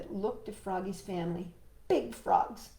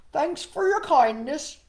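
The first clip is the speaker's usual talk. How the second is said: higher, louder frog farewell; a frog slinking off politely; sheepish frog goodbye; 8 semitones higher, 9 dB louder than usual. Acted. Froggy leaving.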